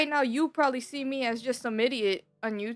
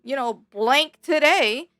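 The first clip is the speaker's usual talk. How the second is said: mock pep talk to self; 4 semitones higher, 6 dB louder, slower than usual. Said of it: "I used to just be like".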